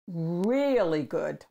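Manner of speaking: ordinary delivery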